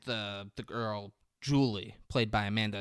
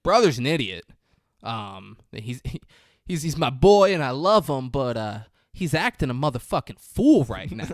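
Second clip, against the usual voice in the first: louder hammed-up bro talk; mock tough talk; 3 semitones higher, 8 dB louder than usual. Emile Hirsch's character vouching for his brother, louder. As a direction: vouch for him loudly, forced. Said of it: "He's like".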